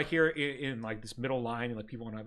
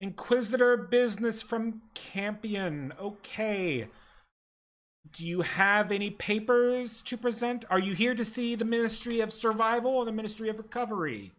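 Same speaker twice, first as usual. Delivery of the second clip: higher, prim bureaucratic clerk voice; desk clerk; 6 semitones higher than usual. Acted. Ministry clerk greets the ragged party, primly bureaucratic. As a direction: recite the rules like a tired official.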